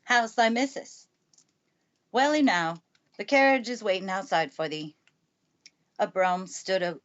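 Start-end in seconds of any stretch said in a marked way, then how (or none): 0.0-1.1 gruff, louder Yorkshire station master; an admittedly shaky Yorkshire brogue, pitched higher; Yorkshire accent. Acted. Station master greeting the housekeeper, in a Yorkshire accent. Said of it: "pronouncing his words in a queer, broad fashion". 2.1-5.0 put-on Yorkshire accent. Housekeeper telling the station master the carriage waits, Yorkshire accent. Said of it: "speaking with a Yorkshire accent herself".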